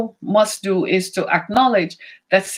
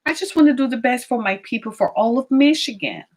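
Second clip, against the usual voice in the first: ordinary talk, nothing marked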